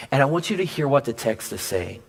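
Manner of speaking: ordinary delivery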